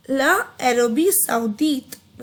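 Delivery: ला अरबी सऊदीत said slowly and linked; French pronunciation. Shown as a demonstration. Teacher slowly modelling French for students. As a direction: stretch out ला अरबी सऊदीत carefully. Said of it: "Saudi Arabia में आ जाओ अब".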